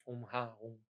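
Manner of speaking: "Om Ha Hum" quietly, with an h sound on the middle syllable; quiet repeat of the mistaken "Ha"; wrong pronunciation. Shown as a demonstration. Teacher repeating the error to warn students, mispronounced. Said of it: "A é diferente de Ra".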